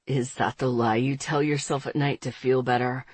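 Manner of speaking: low male voice with hidden laughter; amused Andre. A narrator's reading. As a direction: rumble it, barely holding back a laugh. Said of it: "voice still rumbling with buried mirth".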